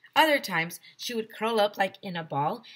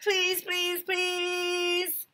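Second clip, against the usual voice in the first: high, drawn-out whine; begging; 12 semitones higher, much slower than usual. Acted. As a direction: plead like a kid. Boy begging in story.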